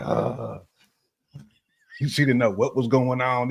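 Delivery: usual speaking voice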